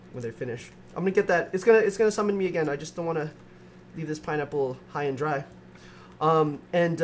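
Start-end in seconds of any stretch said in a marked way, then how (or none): none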